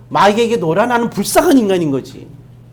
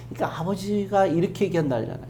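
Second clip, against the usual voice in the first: normal talking voice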